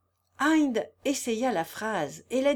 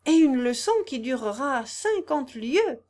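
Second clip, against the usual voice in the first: louder, higher in private delight; rapturous thought; 4 semitones higher, 6 dB louder than usual. A narrator's reading. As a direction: savour it in rapture. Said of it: "pensa-t-il dans son ravissement".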